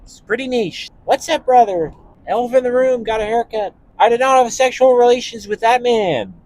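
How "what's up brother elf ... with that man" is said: much higher, louder put-on streamer voice; Sketch impression. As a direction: go big and goofy with the catchphrases. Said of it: "That's my other impression I can do".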